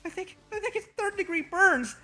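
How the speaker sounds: high, hammed-up sniffly whine; mock sniffling. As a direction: fake tears for sympathy. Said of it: "I sniffle".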